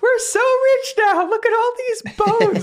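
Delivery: very high squeaky skeleton glee; gleeful skeleton. Acted. Skeletons gloat over piles of bones, squeaky and high. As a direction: squeal with greedy delight.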